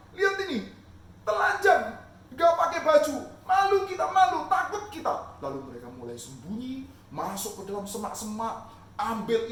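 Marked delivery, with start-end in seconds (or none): 0.1-5.4 high, loud panicky shame; ashamed and scared. Acted. Adam and Eve discovering they are naked, high loud panic. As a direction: cry out in embarrassed alarm.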